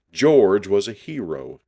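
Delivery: plain reading voice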